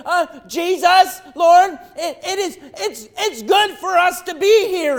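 high, loud, stammering awe; flustered Peter. Retold or imitated. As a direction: stumble over the words in dazed wonder. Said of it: "And answered Peter and said".

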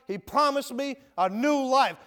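usual speaking voice

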